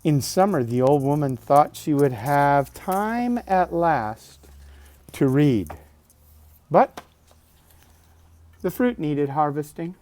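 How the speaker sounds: bright, lifted storybook voice; reading to children. Acted. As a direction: read it out like a bedtime book. Reading picture book aloud.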